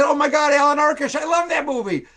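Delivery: high, gushing fanboy excitement; imitating Spielberg's high, excited gushing. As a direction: gush like a thrilled fan.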